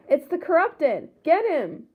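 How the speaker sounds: a townsperson shouting at the attacker; hammed-up angry cry; screamed. A narrator's reading. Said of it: "screamed one person".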